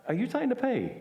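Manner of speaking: amazed mock question; incredulous. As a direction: ask it like you can't believe it. Disbelieving question to crying brother.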